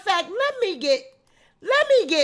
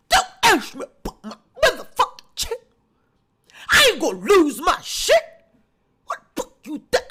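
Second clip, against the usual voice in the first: loud, fast, higher mock rant in another voice; ranting Tasha; 6 semitones higher, 4 dB louder than usual. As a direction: scream at the camera like a furious diva. Comic rant voicing Tasha.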